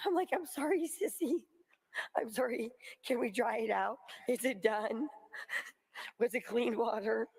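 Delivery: ordinary talk, nothing marked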